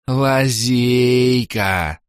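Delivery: slow bubbling echo of Лазейка; gurgling tentacle alien. Acted. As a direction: gurgle it again, drawn out. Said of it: "пробулькал из лужи Орбит".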